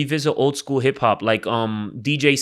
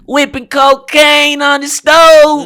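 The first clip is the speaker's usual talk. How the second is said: loud high nasal rap delivery; nasal rapper voice; 14 semitones higher, 10 dB louder than usual. Retold or imitated. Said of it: "a hot nasally voice".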